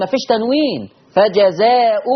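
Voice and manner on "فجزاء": فجزاء ending in plain -u; no nasal n after the hamza in فجزاء; no tanween. Shown as a demonstration. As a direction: say فجزاء as "fajazaa'u". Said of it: "بس دون تنوين".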